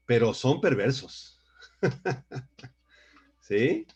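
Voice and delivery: ordinary delivery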